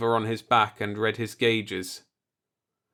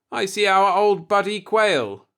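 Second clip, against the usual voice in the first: put-on higher, louder drunken crewman; tipsy insistence; 9 semitones higher, 6 dB louder than usual. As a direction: insist loudly through the booze.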